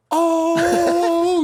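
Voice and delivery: high, loud falsetto whoop; drawn-out high cheer of mock awe; mock hype. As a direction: imitate teammates hailing a champion. Teammates greet him as he enters.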